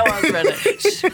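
laughing while she talks; giggly self-mockery. Real, not acted. Laughing at own bad accent.

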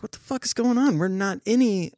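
normal talking voice

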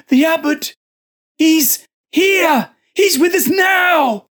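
high, crying shriek of terror; shrill scream. Acted. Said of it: "Joseph screamed, a shrill, girlish sound".

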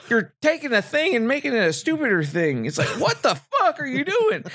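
high-pitched, incredulous complaint; exasperated protest. Retold or imitated. Fan berating filmmakers for making it stupider, exasperated protest.

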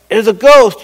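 acted cry of alarm; cried out in fear. A narrator's reading. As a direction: call it out as a frightened exclamation. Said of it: "they cried out in fear".

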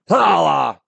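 loud high holler; catchphrase shout. Acted. Host opening the podcast with a loud holler. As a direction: bark out the catchphrase.

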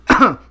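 sudden choking cough; cough, choking on spit. Real, not acted. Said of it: "I'm choking on my own spit".

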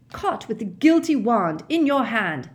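loud accusing roar. A narrator's reading. Mister Diggory accusing Winky, loud roar. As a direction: hurl the charge at her. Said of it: "Mister Diggory roared".

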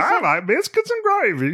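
high-pitched mock Southern. Host joins the voice game, high Southern accent. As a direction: put on a high Southern twang. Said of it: "like, Southern".

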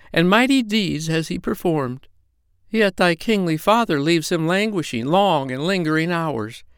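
high, solemn fairy queen; tiny tinkling fairy voice. Pretend. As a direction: pronounce it like a fairy oracle. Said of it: "small, high voice like a little girl".

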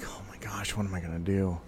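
a panicked aside to himself; mock muttered dread; hushed panic, retold. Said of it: "I'm like".

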